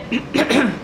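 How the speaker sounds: a short rasping throat clear. Real, not acted. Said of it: "I have a frog in my throat".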